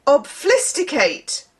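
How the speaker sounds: normal talking voice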